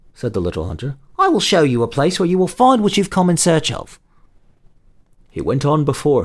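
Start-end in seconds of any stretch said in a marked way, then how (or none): 1.2-4.0 open and friendly. Acted. Little hunter offers help.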